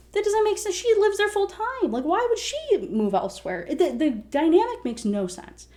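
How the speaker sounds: high-pitched, incredulous protest; exasperated disbelief. Real, not acted. Reader objecting to the plot, exasperated. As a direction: argue it in baffled exasperation.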